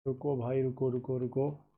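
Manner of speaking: soft, low stalling while searching; absorbed muttering. Real, not acted. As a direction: murmur it half to yourself, busy looking.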